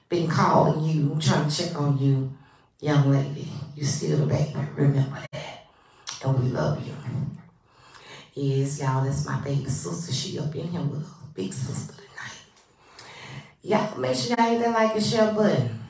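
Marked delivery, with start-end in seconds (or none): 3.7-6.9 whispered tender aside. Acted. Tender note to her sister. 10.1-12.4 a soft, whispered brag; whispered aside. Acted. Host telling viewers her sister is watching, in a whisper.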